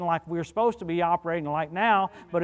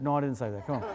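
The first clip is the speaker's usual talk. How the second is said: ordinary delivery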